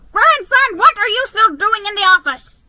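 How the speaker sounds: high, loud booming shout at grandson; thundering grandfather. A narrator's reading. Grandfather bursts in, thundering at his sleeping grandson. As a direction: bellow the question like an angry old boss. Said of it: "he thundered".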